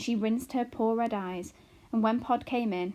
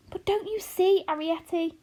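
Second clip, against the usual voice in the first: gasping; 9 semitones higher, 6 dB louder than usual. A narrator's reading. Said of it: "gasped Homily".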